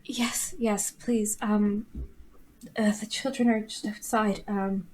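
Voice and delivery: soft, breathless whisper; hushed whisper. Acted. Grieving woman hushes near children.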